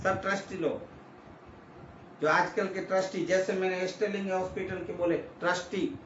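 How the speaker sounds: ordinary delivery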